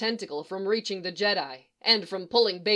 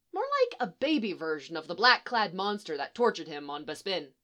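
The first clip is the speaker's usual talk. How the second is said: plain reading voice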